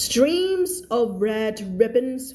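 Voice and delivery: very slow, careful reading; slow read-aloud. Shown as a demonstration. Teacher reading the story line to her class, very slow. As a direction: read it word by word for learners. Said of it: "teacher reads sentence slowly".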